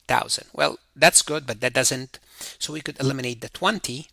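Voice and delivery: normal talking voice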